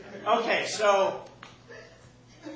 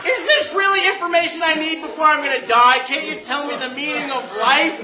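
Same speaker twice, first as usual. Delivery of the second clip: loud, high comic indignation; exasperated outburst; 10 semitones higher, 10 dB louder than usual. Acted. Jumper about to die, protesting in exasperated shouts. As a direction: rant in disbelief at useless information. His acted outrage at the lady.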